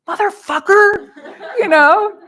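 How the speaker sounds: high, loud laughing curse; mock road rage. Teacher acting her road rage at the truck driver, mock furious. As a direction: spit the curse out half-laughing. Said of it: "I start seething".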